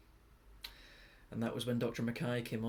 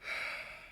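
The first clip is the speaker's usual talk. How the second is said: long breathy sigh; heavy sigh; 6 dB quieter than usual. Acted. Recalling the office with parents, a soft sigh. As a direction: let out a soft, drawn breath. Pause before the diagnosis is told.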